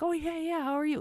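high, quick brush-off hello; high breezy greeting. Retold or imitated. Sportscaster greeting a fan mid-show, high and breezy. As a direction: chirp it like a busy host. Retold John greeting her briefly.

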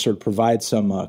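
usual speaking voice

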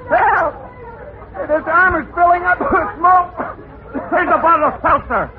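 very high, frantic screams for help; panicked yells. Acted. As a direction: scream like you're burning. Smoke filling his armor.

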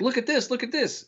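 high-pitched mock excitement; excited urging. Professor showing a visitor his cake stand, excited. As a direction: imitate someone eagerly showing off a find.

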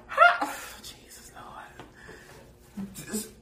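breathy whispered disbelief, rising then dropping; whispered exasperation. Real, not acted. Reacting to the player's dumb lie, whispered disbelief.

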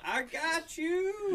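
high and very slow; drawn-out dog reassurance. Retold or imitated. Dog joining its packmate, high and slow. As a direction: stretch it out like an eager dog.

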